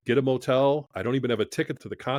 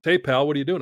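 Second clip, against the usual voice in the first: louder, higher, jovial hello; cheerful greeting; 4 semitones higher, 5 dB louder than usual. Retold or imitated. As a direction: greet an old pal warmly over the phone. John calling him on the phone.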